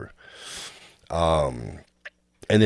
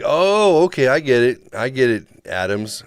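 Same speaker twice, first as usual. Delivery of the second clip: excited; 8 semitones higher, 8 dB louder than usual. Retold or imitated. Mock realization to therapist.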